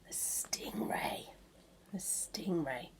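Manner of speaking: hushed, breathy whisper of the name; spooky hushed whisper. Shown as a demonstration. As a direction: whisper it like a secret. Dramatic whisper of creature name.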